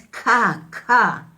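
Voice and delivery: ca with a strong breath after the k; aspirated ca. Shown as a demonstration. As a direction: push air out on ca.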